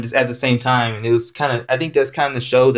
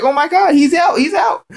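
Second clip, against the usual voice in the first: excited shout. Retold or imitated. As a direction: shout it like a fan at the big moment. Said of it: "I was like".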